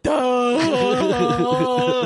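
long drawn-out dopey "duh"; mock dumbstruck noise. Host correcting co-host's impression of Jane, drawn-out and dopey. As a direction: hold it out like a slack-jawed dope. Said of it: "it's more like she's like".

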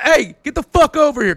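loud, high imitated call; rough summons. Bikers demand a picture with comic, mock bossy shout.

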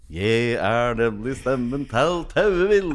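loud, slow cartoon character voice; Tauevill. Shown as a demonstration. Actor revealing himself to teenage confirmands, loud character voice. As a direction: boom it out, drawn out, in character. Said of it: "den stemmen der, og den Tauebilen".

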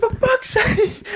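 very high, drawn-out squeal of despair; high mock-despairing cry; exasperated wail. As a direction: wail it slowly, half laughing. Retells phone dying mid-booking.

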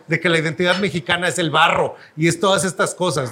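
ordinary delivery

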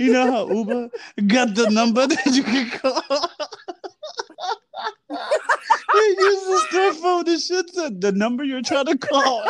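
high-pitched, cracking up while talking; laughing through it. Real, not acted. Storyteller recalling the phone message to co-host, laughing. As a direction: tell it through helpless laughter.

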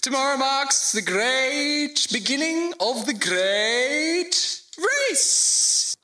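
high, slow, pompous lord's proclamation; warm regal boom. Acted. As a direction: announce yourself grandly to your subjects. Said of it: "a rich melodious voice".

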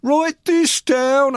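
hammed-up Bill, high and slow; ranting drummer. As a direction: drag out the demand, high and loud.

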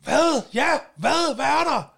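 high-pitched, re-enacted irritated "what"; exasperated snapping. Harassed man turning on the stranger pestering him, retold exasperation. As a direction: whip round and demand what they want.

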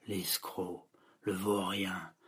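low, soft, disdainful name-calling; low muttered insult. Acted. Girl condemning her mother's boyfriend, low muttered scorn. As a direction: mutter the insults under your breath.

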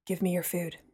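low, hushed mutter aside. A narrator's reading. Apollo muttering his request to the narrator under the music, hushed. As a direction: mutter it so only one person hears. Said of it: "Apollo muttered to me".

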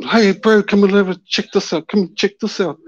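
high-pitched urgent call to a mate; reenacted excited summons; retold calling out. Calling his mate over.

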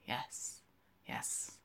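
breathy whispered yes; whispering. A narrator's reading. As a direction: whisper it to yourself. Whispered narration aside.

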